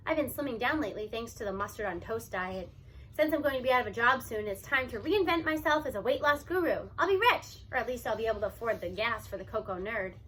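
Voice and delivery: plain reading voice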